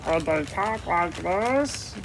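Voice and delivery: slow, laughing voice rising at the end; a goofy character, giggling through it; slow silly puppet voice with a laugh; another character voice. Shown as a demonstration. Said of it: "Think of a voice".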